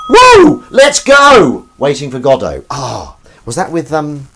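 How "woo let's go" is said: loud, high whoop; excited cheer. Real, not acted. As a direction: whoop it out at full volume. Hyped cheer to the viewers.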